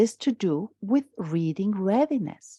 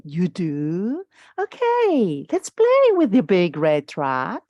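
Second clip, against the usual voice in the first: put-on louder, swooping parent talk to a baby; parentese; 4 dB louder, slower than usual. Shown as a demonstration. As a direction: coo at a baby like a singing parent. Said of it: "So you're really singing".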